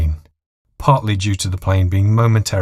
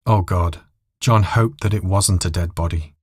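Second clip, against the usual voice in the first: usual speaking voice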